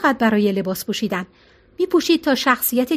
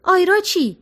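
higher, raised voice calling up the stairs; a mild narrated shout, higher; called out; 7 semitones higher than usual. Acted. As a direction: call after her, pitched up. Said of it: "مگی فریاد زد".

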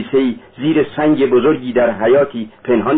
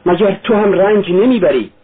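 higher, pleading cry; 7 semitones higher than usual. A narrator's reading. Sonia cries out to Raskolnikov, high and pleading. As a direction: burst out in protest. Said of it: "سونیا فریاد زد".

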